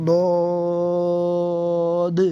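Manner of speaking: ضاد held very long and heavy, in Arabic style; long drawn ضاد. Shown as a demonstration. Teacher showing students the letter name, drawn out. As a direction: stretch ضاد slowly and fully. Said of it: "یہ دیکھا ہے".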